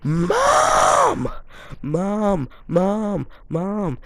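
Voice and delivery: first call a very high full shout, then fading calls; yelling for mom. Retold or imitated. Boy calling his mom to the burning room, shouting. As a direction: scream for mom, then let the calls wind down. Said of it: "I just yell".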